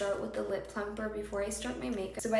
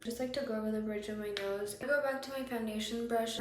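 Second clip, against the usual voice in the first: ordinary talk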